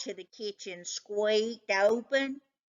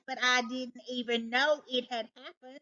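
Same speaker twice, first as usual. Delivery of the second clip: ordinary delivery, nothing marked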